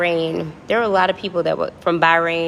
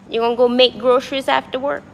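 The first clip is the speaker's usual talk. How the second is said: light New Orleans accent, pitched up; 5 semitones higher than usual. Shown as a demonstration. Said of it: "That's my New Orleans accent".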